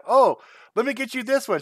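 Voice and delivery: high, eager voice telling wife to pick this one; excitedly offering a gift to the wife. Retold or imitated. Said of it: "I was telling my wife".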